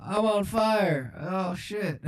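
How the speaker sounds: slow, high-pitched doddering president; comic presidential impersonation; Joe Biden. Acted. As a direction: announce disaster as a befuddled old politician. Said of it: "I'm just Joe Biden on the track".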